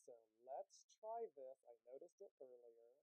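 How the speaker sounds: faint, distant earbud microphone; earbuds mic. Real, not acted. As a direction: speak through a weak earbud mic. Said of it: "my sound is not working".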